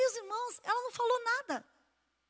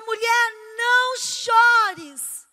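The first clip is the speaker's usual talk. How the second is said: "não chores" stretched very slow and louder, each syllable held; solemn comfort; 2 semitones higher, 9 dB louder, much slower than usual. Acted. Jesus addressing the weeping widow, very slow and solemn. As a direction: proclaim "Mulher, não chores" slowly, with full weight.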